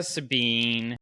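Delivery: ordinary talk, nothing marked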